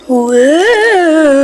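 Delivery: surprised. Real, not acted. Sudden reaction mid-reading.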